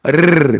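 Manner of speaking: 르르르 as a long tongue-tip trill; rolled r. Shown as a demonstration. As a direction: roll the tongue through 르르르. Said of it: "알 발음이 굴러가죠". (혀를 굴려 '르르르')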